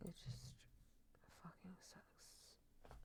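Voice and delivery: slow, hushed whisper; barely-there whispered curse; whispered under the breath. Real, not acted. Whispered curse about dad.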